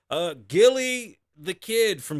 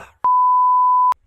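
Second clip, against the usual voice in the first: extremely high, loud shriek of laughter; 12 dB louder than usual. Real, not acted. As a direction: scream with laughter. Laughing at son's joke.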